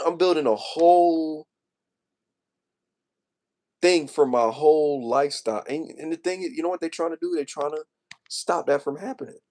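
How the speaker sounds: really worked up, pleading his case; emotional. Real, not acted. Telling viewers of efforts to stop him, emotional. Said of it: "I'm sorry y'all hearing me like this".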